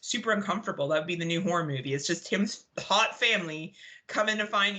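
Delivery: ordinary talk, nothing marked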